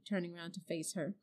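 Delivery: usual speaking voice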